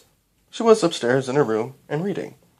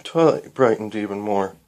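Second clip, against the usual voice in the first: ordinary delivery, nothing marked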